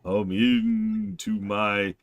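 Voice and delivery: slow drawn-out ghostly ooh; spooky ghost moan. Acted. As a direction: moan it like a haunting spirit. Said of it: "I can't do a Spanish accent".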